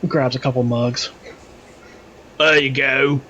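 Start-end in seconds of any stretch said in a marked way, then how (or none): 2.4-3.2 loud, jolly drinking cheer; lightly hammed-up pirate; hearty pirate toast. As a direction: toast your shipmates like a pirate.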